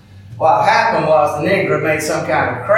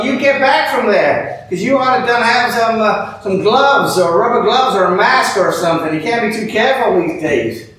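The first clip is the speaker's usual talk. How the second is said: raised warning; 6 semitones higher than usual. Acted. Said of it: "I tell her, though".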